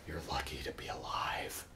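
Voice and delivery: whispered, very soft; near-silent whispered aside; hushed awe. Retold or imitated. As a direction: breathe it out in a stunned whisper. Acts out coworker's awed reply.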